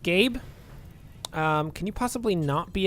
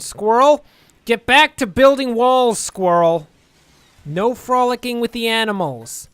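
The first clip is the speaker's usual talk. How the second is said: yelling an order; 3 semitones higher, 7 dB louder than usual. Real, not acted. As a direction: call out sharply across the base.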